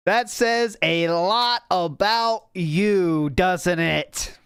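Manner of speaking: drawn-out, deliberate delivery; slow, knowing dig at someone; slow, pointed repeat. Real, not acted. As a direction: stretch each word to rub it in. Repeats the line slowly for effect.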